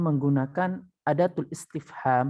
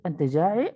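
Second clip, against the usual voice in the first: "Anta ja'i" rising on ja'i; questioning intonation; 2 semitones higher than usual. Shown as a demonstration. Teacher showing Arabic works the same, questioning intonation. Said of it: "itu udah intonasi bertanya".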